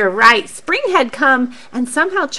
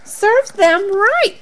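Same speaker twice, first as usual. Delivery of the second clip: smug; 9 semitones higher, slower than usual. Acted. Charlie thinks smugly.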